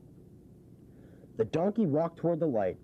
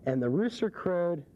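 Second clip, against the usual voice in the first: plain reading voice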